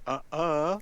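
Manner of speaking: high, loud guilty "uh-uh"; guilty denial. Retold or imitated. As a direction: deny it like a caught kid. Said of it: "sounded like a guilty".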